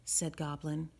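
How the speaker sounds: ordinary delivery, nothing marked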